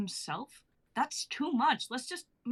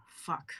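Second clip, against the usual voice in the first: softer, lower sighing curse; sighed curse; 5 dB quieter than usual. Acted. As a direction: breathe it out in frustration. [name] sighing after vaping.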